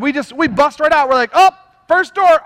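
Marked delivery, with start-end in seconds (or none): 1.3-2.4 higher, louder eager burst; hammed-up impulsive excitement; mock eager. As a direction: bolt at the chance. Mocking impulsive believer.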